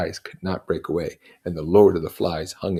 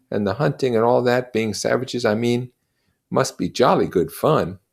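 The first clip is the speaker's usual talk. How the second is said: put-on raised boyish schoolboy voice, keen; a keen English schoolboy pushing his plan; Bill, boyish and eager; 5 semitones higher, 7 dB louder than usual. Schoolboy Bill urging the others to the feast, keen. Bill's voice in reading.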